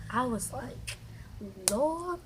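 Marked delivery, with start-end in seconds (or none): 1.7-2.2 high-pitched retold plea to heaven; pleading prayer. Rider retelling how she prayed to get off, pleading. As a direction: call out as if begging for rescue. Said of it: "I started praying. I started calling Jesus".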